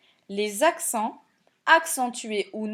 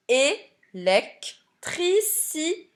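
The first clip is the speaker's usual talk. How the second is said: "Électricité" slow and higher, é-lec-tri-ci-té; syllable by syllable; 5 semitones higher, much slower than usual. Shown as a demonstration. Teacher checking where accents go, "Électricité" slowly split by syllable. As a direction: break "Électricité" into raised, separate syllables. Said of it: "Je le coupe en syllabes".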